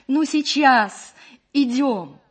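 high, loud, drawn-out call. A narrator's reading. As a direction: shout back impatiently that you're coming. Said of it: "и крикнула".

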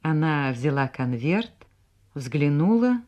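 usual speaking voice

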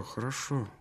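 softly. Acted. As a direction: let the word fade to almost nothing.

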